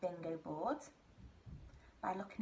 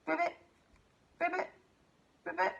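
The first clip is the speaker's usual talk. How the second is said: quick, raised-pitch mock ribbits; frog croak imitation; 7 semitones higher, 3 dB louder, faster than usual. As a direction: croak like a frog in short separate bursts. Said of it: "Shall I do it again".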